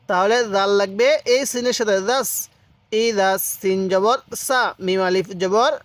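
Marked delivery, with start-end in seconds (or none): none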